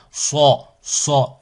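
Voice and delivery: So with a dark, backed vowel, unlike "sa"; heavy emphatic S on So; emphatic, grave S. Shown as a demonstration. Teacher contrasting So with plain sa, emphatic and dark. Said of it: "et pas ça".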